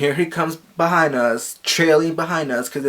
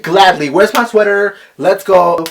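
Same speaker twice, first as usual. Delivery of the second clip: sassy retort; 5 semitones higher, 8 dB louder than usual. Retold or imitated. Sassy exit after being kicked out.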